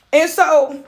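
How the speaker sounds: ordinary talk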